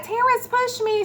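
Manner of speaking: very high, playful accusation; mock tattling. Acts out tattling on her son.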